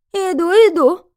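high, loud honeyed call. A narrator's reading. Landlady answering the doorbell, honeyed. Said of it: "послышался медовый голос".

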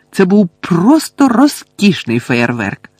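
high, slow, gushing praise; delighted admiration. A narrator's reading. Hermione congratulates the twins on their fireworks, delighted. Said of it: "захоплено визнала вона".

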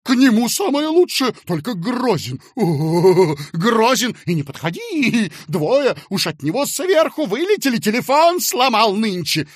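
high, loud, flustered wail; alarmed warning. Acted. Fat man warning clerk of a fearsome boss, alarmed warning.

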